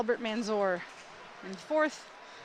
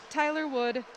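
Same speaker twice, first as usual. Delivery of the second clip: usual speaking voice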